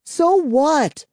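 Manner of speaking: high, loud irritated snap; frustrated cry. Acted. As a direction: cry out impatiently. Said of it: "Kara cried".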